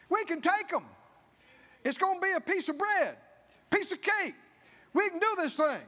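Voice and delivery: high, loud, eager urging; rally the people with big confident faith; Joshua and Caleb urging. Retold or imitated. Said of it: "tried to convince 'em and said".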